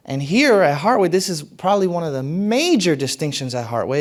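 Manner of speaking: ordinary talk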